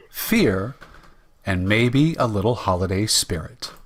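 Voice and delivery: usual speaking voice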